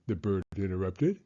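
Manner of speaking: ordinary delivery, nothing marked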